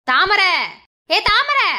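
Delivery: insistent loud name-calling. Acted. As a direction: call out to someone who isn't responding. Calling dazed daughter-in-law sharply.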